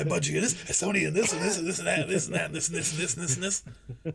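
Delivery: high, loud, rushed rant; frantic ranting. Retold or imitated. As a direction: rattle it off like a conspiracy. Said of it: "it's like".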